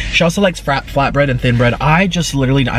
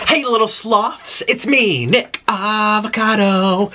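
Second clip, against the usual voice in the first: higher, slow sing-song greeting; vlog intro voice; 5 semitones higher, slower than usual. Real, not acted. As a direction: greet your fans brightly.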